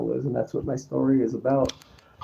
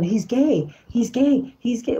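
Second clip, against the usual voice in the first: repeated taunt in a much higher voice; accusing gossips; 9 semitones higher, slower than usual. Retold or imitated. People talking about him behind his back, high mocking imitation. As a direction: mimic accusers, pitched well up. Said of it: "I hear people saying".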